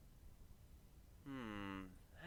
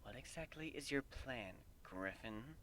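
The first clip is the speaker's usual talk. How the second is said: quiet question; 2 semitones lower, 19 dB quieter, slower than usual. A narrator's reading. Celestia asks quietly.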